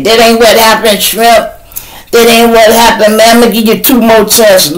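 high, loud nagging voice; mocking a commenter. Mocking a commenter who corrected his story, high and loud. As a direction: whine it high like a know-it-all troll.

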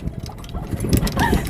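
high squealing laugh; loud burst of laughter. Real, not acted. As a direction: burst out laughing at the bad shot. Laughs after hitting tree.

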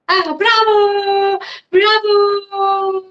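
high, loud sing-song bravo; cartoon princess. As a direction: cheer like a delighted princess. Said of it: "said Princess Elizabeth".

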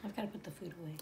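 unvoiced whispered aside. Real, not acted. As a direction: whisper it under your breath. Aside while partner talks.

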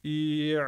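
long "İ" tightening into ayn; squeezed onset. Shown as a demonstration. Teacher pointing out the onset, stretched "İ" into tight throat. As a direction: hold "İ", then constrict the throat. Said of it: "diye giriyoruz".

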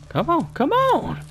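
high, slow, drawn-out dare; taunting call. Acted. Gamer daring cannibals in the trees, slow high taunt. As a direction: stretch it out as a jeering challenge.

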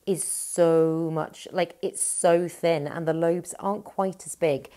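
ordinary talk

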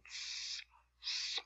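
"s" as a long voiceless hiss with no vowel; emphatic s sound. Shown as a demonstration. Teacher showing the sad sound as a bare soft hiss. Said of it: "So this is the sound".

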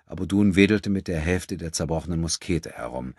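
ordinary delivery, nothing marked

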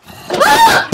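high, loud startled shriek; startled scream. Real, not acted. Gamer jumped by a zombie, startled scream. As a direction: scream out in sudden shock.

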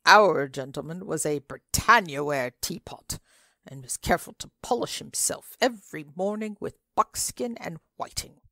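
ordinary delivery, nothing marked

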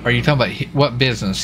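usual speaking voice

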